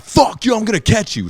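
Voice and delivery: high, re-enacted furious shout; acted outburst, yelling after someone; snapping in anger. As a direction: explode into a high-pitched threat. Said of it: "and I snap".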